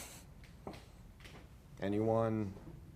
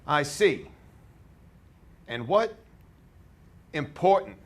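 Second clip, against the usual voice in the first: loud, higher, projected; more volume; 5 semitones higher, 8 dB louder, slower than usual. Acted. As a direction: project it to the back row. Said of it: "give me some more volume".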